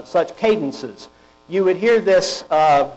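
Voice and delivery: ordinary delivery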